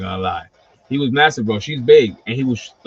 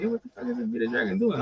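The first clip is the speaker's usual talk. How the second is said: shocked disbelief; 10 semitones higher, 7 dB quieter, faster than usual. Retold or imitated. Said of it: "I was like".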